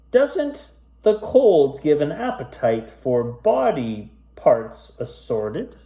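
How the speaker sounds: spooky rhyming storybook read; story narration. A narrator's reading. Reading a creepy rhyming tale to kids, storyteller voice. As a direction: read it aloud like a bedtime ghost tale. Reads a spooky rhyme aloud.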